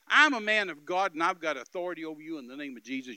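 loud, indignant opening that settles down; retold angry declaration of authority; mad. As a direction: hit it hard, then ease off. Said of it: "it just made me mad".

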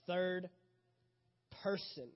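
THIRD, pause, PERSON, each set apart; emphasis on PERSON. Shown as a demonstration. Preacher stresses the series title to congregation, emphasis on PERSON. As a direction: land PERSON firmly after a long pause. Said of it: "Emphasis on the person".

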